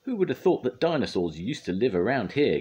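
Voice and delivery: high, loud amazement; astonished, high-pitched wonder; Wendy. A narrator's reading. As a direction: marvel with a gasp in the voice. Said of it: "gasped Wendy".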